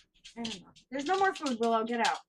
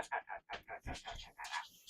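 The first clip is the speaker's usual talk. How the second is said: soft whispered out, out, out to send the dog away; whispered shooing; 21 dB quieter than usual. Real, not acted. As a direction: hiss the dog out of the room under your breath.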